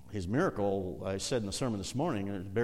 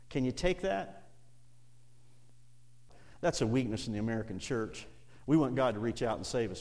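ordinary talk

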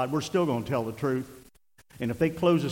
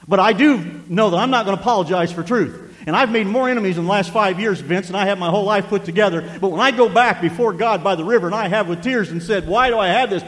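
raised, higher, impassioned preacher voice; full-force preaching; 6 semitones higher, 9 dB louder than usual. Real, not acted. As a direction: drive the truth home loudly from the pulpit.

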